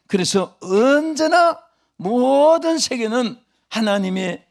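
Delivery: loud, high, rising preaching cry; full-force preaching. Acted. Revival preacher crying out to his congregation, loud and high. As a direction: thunder the power of God to the hall.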